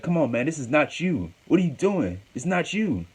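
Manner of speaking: high-pitched retold appeal; imitated brotherly plea, pitch lifted high; pleading reason. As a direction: plead with him to see sense. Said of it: "trying to talk sense into Roman Reigns".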